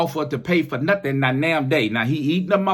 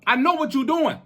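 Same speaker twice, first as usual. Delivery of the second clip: usual speaking voice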